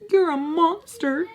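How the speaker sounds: high-pitched playful movie-line imitation; nephew quoting a line. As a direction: mimic a kid repeating a favorite line. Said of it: "My nephew repeats the".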